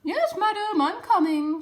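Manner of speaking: high, loud obedient reply; dutiful maid. A narrator's reading. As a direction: call back like a servant. Maid answering mistress.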